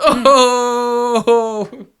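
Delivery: long, loud high hoot; mock "oh" howl. Host exaggerating the man's cry, endless high hoot. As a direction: hold one high note in exaggerated mimicry. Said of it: "He goes".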